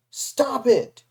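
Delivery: loud, high shout. A narrator's reading. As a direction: stop him cold with a cry. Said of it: "she shouted".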